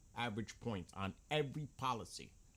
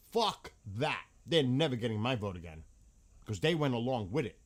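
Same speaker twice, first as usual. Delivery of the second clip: angry rant; 6 dB louder than usual. Real, not acted. Angry rant about prices, vote.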